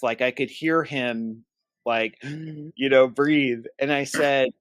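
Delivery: ordinary delivery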